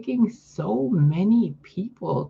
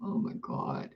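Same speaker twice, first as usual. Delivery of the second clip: whispered disbelief; 11 dB quieter than usual. Real, not acted. Disgusted by the cat.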